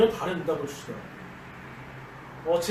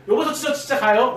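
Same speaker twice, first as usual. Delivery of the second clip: louder, higher call; 6 semitones higher, 8 dB louder than usual. Retold or imitated. Opening the door, calling loudly to his wife. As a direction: call again, pressing for an answer.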